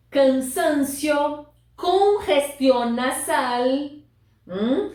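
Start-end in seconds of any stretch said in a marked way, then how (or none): 0.1-3.6 slow model words. Shown as a demonstration. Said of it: "teacher modelling vocabulary".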